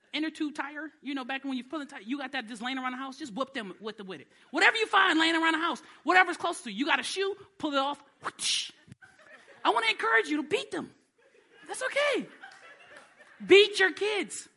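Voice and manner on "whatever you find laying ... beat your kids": high loud laughing shout; excited preaching. Real, not acted. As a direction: whoop it up, laughing.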